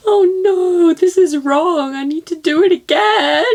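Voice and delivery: loud, high-pitched protest; high wailing disbelief; dismayed wail. Real, not acted. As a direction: cry out that it's all wrong. Protesting the wrong result.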